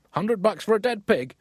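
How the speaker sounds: outraged farmer. Retold or imitated. Cutler balking at a hundred bucks for his pig, mock outrage. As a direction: squeak out the disbelief at the price.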